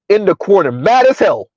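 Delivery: ordinary talk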